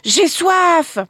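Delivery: high, urgent cry of thirst; burning cry. Acted. The boy breaking free, crying out high with thirst. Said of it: "il cria".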